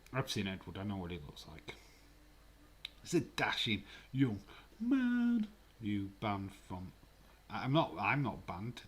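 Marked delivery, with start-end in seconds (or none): 3.0-5.5 much higher, slow, louder swooning voice; hammed-up dreamy praise; mock swooning. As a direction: gush over him like an admirer.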